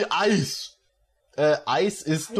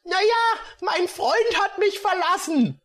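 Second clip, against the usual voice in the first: put-on much higher girlish lament; high, plaintive heroine voice; girl's voice; 12 semitones higher than usual. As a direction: complain like a heartbroken girl. Regine's voice in the scene.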